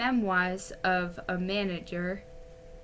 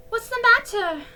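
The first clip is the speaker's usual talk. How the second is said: put-on girlish voice; 14 semitones higher, 8 dB louder than usual. A narrator's reading. Girl voiced, high.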